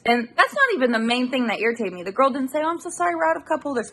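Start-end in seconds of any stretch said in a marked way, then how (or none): 2.6-3.9 high, sugary pretend politeness; mock sweet apology. Mocking the cashier who never apologised, sugary fake politeness. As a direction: put on a fake-nice cashier voice.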